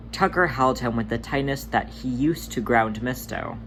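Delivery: ordinary delivery, nothing marked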